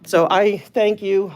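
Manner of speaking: ordinary delivery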